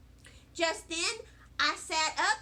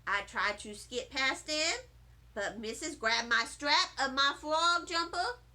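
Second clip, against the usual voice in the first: plain reading voice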